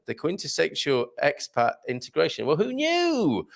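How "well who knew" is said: high, drawn-out playful wonder; mock amazement. Host reacting to soap factory trivia, mock amazement. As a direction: stretch it out high in exaggerated surprise.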